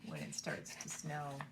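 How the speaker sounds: sing-song storybook reading voice; reading to children. Acted. Teacher reading When It Starts to Snow aloud. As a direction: read it like a picture book at circle time. Reads picture book to children.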